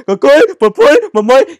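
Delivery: high, loud goofy call; mock excited shout. Friend calling out to the girls, high and loud. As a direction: hype it up like a clown. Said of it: "this man was like".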